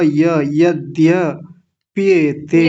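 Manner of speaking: यद्य slowly, with a clear half द joined to य; careful teacher drilling the student; slow syllable drill. Shown as a demonstration. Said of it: "आधा द".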